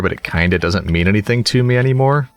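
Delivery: ordinary talk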